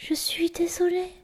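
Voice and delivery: whispered meek imitation; thread of voice. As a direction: barely breathe the apology. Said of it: "vraiment le petit filet de voix".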